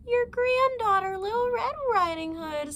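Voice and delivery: put-on a big wolf squeaking to pass as a child; wolf faking a girl's voice. Wolf at the door fakes the granddaughter's voice, squeaky and high. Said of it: "disguising his voice".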